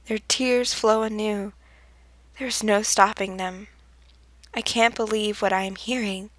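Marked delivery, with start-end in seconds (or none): none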